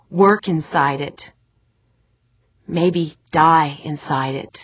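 ordinary delivery

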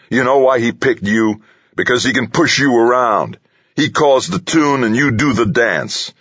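high, heated accusation; angry taunting. A narrator's reading. Delaney taunts Harry for being pushed around, angry taunting. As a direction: throw it in his face, pitch up.

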